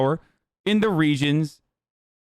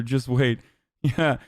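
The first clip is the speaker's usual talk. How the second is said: normal talking voice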